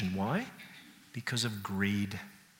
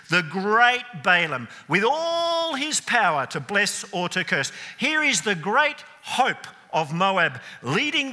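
louder, higher, sarcastically grand build-up; mock grandeur; 9 semitones higher, 5 dB louder than usual. Preacher mocking the great seer stopped by a donkey, sarcastic grandeur. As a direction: puff Balaam up to mock him.

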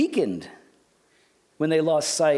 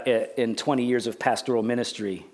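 ordinary talk, nothing marked

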